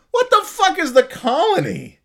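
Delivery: high incredulous outburst; real disbelief at a weird town name; baffled. Real, not acted. Podcaster baffled by a fan's town name, high and incredulous.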